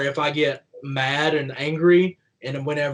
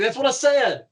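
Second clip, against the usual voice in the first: indignant country twang; 10 semitones higher than usual. Retold or imitated. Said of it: "the country twang that comes out".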